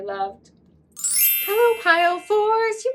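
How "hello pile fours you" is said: sing-song hello. Real, not acted. Greeting the next pile of viewers.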